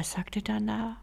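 ordinary delivery, nothing marked

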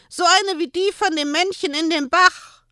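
put-on high pleading little boy voice; high child voice, pleading; begging child. A narrator's reading. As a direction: beg like a small child. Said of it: "bettelte er".

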